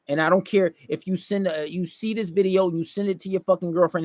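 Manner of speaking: normal talking voice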